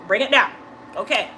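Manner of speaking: usual speaking voice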